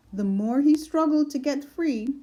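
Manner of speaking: ordinary delivery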